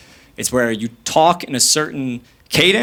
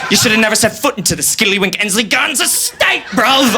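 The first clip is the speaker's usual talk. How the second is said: higher-pitched mock British street thug; mock British accent; 6 semitones higher than usual. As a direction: threaten like a cartoon British gangster. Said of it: "They have British accents".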